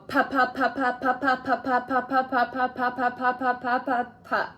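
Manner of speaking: quick, even string of pa pa pa; rapid pa repeats. Shown as a demonstration. Clinician modelling the syllable drill for the patient, rapid even repeats. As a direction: rattle off pa like a drill, no pauses. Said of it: "pa as many times as you can".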